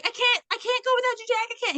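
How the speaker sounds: high, fast pleading Rose voice; hammed-up Rose begging Jack. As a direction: plead in a mock girly voice.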